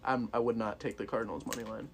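ordinary talk

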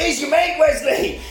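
high, loud taunting shout; mock shout. Swayze shouting at Wesley across the water, a mock shout. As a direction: yell it across the river at him. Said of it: "He's like".

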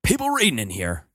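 lightly voiced stern librarian. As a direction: shush the kids like a librarian. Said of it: "That's a library voice".

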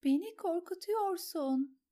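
high, slow, trembling plea; frightened. A narrator's reading. Sansa pleads with her aunt, frightened. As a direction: beg her to stop, scared.

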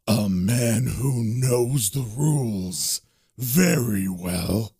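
low, very slow demon voice; grudging, ominous monster voice, stretched out; sneering demon. Acted. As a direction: drawl it deep with a sneer. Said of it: "the demon kind of sneers and says".

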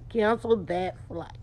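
slow, weighted Cancel that flight; closing the drill with a final no; deliberate refusal. Shown as a demonstration. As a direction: lay down Cancel that flight slowly. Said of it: "That's what I'm gonna call this one".

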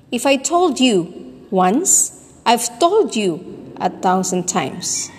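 slow "told you", then "once" set apart with a lift; demonstrated emphatic stress. Shown as a demonstration. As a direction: stretch and stress "told" in both halves. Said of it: "Can you hear the emphasis or the stress".

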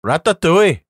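a failed attempt at a Welsh accent on Ratatouille; bad Welsh accent. Shown as a demonstration. Podcast host trying a Welsh accent for friends, badly. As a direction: try a Welsh lilt on "Ratatouille" and miss. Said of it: "that was bad, wasn't it?".

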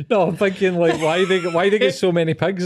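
high, loud, laughing through the words; laughing question. Real, not acted. Co-host cracking up at five-star pig resort, laughing question. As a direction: push the question out over real laughter.

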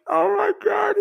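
hammed-up gasp of disbelief; mock shocked shout. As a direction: cry out like something just happened. Coworkers shocked on plane.

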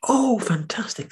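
high, slow breathy whisper; whispered high rabbit delight. Acted. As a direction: gasp it out like an awed little rabbit. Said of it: "said Rabbit".

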